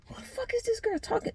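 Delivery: high, hushed inner thought. Retold or imitated. Thinking it to herself as the friend scolds, high and hushed. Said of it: "That's what's going in my head".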